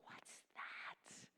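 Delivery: hushed wonder; soft amazed whisper; awed whisper. Retold or imitated. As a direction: whisper it like you've seen something holy.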